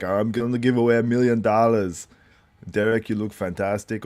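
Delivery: put-on bodybuilding legend's accent; mock stage announcement in an impersonated voice; Arnold. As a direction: imitate a famous bodybuilder addressing the crowd. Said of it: "did a good Arnold impress".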